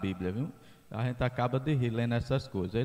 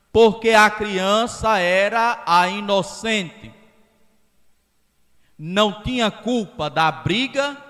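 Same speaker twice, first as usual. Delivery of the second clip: loud, slow, rising sermon voice; preaching at full force; 6 semitones higher, 8 dB louder than usual. Acted. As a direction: hammer the point home to the congregation. Preacher drives home his point.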